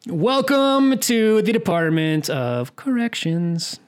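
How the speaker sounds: slow, booming announcer. Acted. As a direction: announce the segment grandly. Segment intro announcement.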